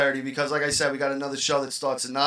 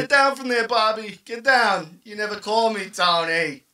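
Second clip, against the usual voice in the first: Saturday Night Fever quote; 4 semitones higher than usual. Retold or imitated. Quoting Saturday Night Fever scene.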